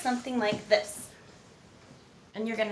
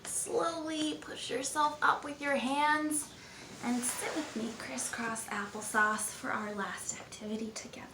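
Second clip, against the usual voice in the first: whisper; 6 dB quieter than usual. Acted. Calming kids after breathing.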